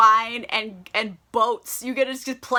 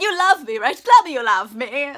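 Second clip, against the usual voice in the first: loud, high, overdramatic plea for love; needy begging; 3 semitones higher, 8 dB louder than usual. Retold or imitated. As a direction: beg for reassurance like a clingy lover. Needy Connie begs for affection.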